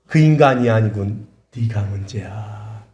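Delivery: slow verdict, dropping hushed and low; the Lord. Retold or imitated. The Lord answers the complaining spouse, slowing to a hush. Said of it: "물으시는 거예요".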